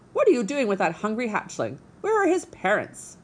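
high, loud indignant squawk; squawking goose. Acted. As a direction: scold like an angry old goose. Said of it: "squawking to the robot".

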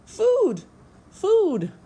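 put-on very high, loud baby-bird squeal; peeping gosling. A narrator's reading. Hungry gosling begging the robot, high loud peep. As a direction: demand it in a piercing chick's peep. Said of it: "peeped the gosling".

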